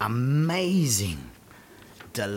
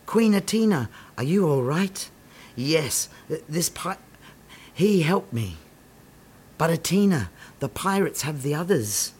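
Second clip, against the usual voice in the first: normal talking voice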